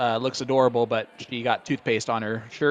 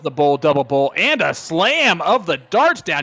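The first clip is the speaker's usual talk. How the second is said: loud call climbing much higher at the end; excited play-by-play; 5 semitones higher, 5 dB louder, slower than usual. Real, not acted. Commentator calling a double bull slam, excited shout. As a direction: punch out the winning throw, rising into excitement.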